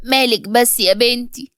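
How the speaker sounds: usual speaking voice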